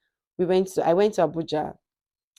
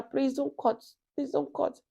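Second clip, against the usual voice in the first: high, softer imploring plea; retold pleading; 8 semitones higher, 5 dB quieter than usual. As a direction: plead with someone not to hang up, pitched well up. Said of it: "I was still pleading with her".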